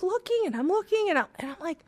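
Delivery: normal talking voice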